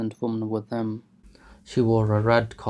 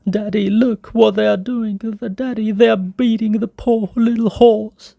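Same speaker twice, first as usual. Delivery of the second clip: put-on much higher, louder boy's plea; distressed child; 12 semitones higher, 8 dB louder than usual. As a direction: beg father to look, upset. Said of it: "he cried".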